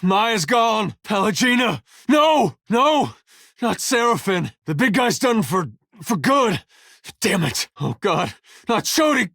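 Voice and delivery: high-pitched, anguished exclamations; urgent horror, voice pushed high; distraught battle cries. Acted. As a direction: cry out as friends fall around you. Reacts to companions dying in battle.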